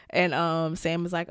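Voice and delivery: normal talking voice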